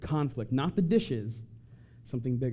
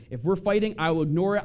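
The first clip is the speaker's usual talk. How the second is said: ordinary delivery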